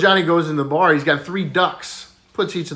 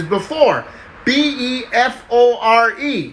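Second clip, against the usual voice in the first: higher, letters laid out slowly; slow spelling; 8 semitones higher, slower than usual. Acted. Johnny spells it for the teacher, slowly and high. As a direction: spell each letter with care.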